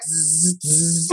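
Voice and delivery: mouth-made electric zapping noise; drawn-out "zzz" zap; imitated taser buzz. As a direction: buzz softly like a pretend taser.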